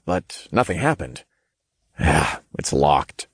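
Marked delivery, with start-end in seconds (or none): 2.0-3.3 low, slow sigh of disappointment. A narrator's reading. Narrator finds the hotel door locked, sighing. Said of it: "I sighed".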